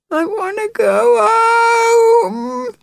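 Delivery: very high, slow crying wail rising on home; rising howl. Acted. Little Jill wails to her sister through tears, high and slow. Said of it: "The last word a rising howl".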